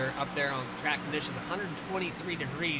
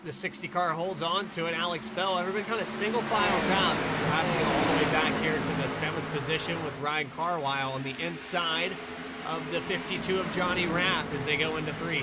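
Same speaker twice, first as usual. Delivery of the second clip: motorsport commentator; 7 dB louder than usual. Real, not acted. Calling the green flag start.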